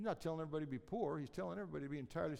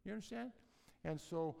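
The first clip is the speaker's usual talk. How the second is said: ordinary talk, nothing marked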